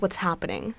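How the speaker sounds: normal talking voice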